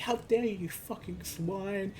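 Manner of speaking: half-serious angry quote; mock outrage. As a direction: imitate someone going off at a partner. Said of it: "She's getting so fucking mad".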